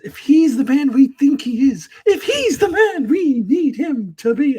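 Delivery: hammed-up dramatic pauses in a Garak quote; William Shatner. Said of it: "making Garak talk like William Shatner".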